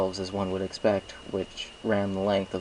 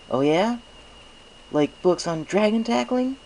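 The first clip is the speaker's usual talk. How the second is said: high, sly mocking jab; teasing; 13 semitones higher, 5 dB louder than usual. Spike teases the stallion who tackled him, high and sly. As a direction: needle him with a grin.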